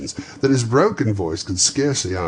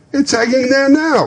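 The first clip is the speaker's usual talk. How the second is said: put-on high, loud boy's voice; a surprised urchin; startled young lad; street boy; 9 dB louder than usual. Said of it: "replied the boy".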